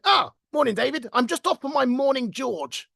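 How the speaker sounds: put-on high-pitched British old-boy greeting; upper-class naval boss. Old boss greeting an employee one morning, plummy upper-class British. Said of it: "actually spoke very much like that".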